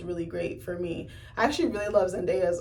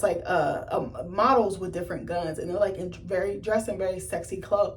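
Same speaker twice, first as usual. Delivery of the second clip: ordinary talk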